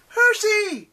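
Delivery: high, loud call of alarm; urgent, high-pitched shout; distant cry. A narrator's reading. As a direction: cry out the name as if from afar. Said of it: "Annabeth's voice sounded far away".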